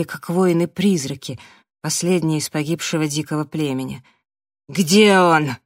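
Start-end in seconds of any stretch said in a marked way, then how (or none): 4.7-5.6 loud, fierce demand; fierce, forceful question; fierce exhale. A narrator's reading. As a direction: breathe it out savagely, loud. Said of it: "свирепо выдохнул Раф".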